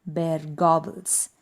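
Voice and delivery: plain reading voice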